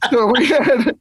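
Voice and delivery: can barely get it out for laughing. Real, not acted. Misquoting the joke to the cohosts, laughing hard.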